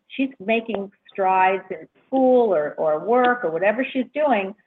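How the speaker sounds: normal talking voice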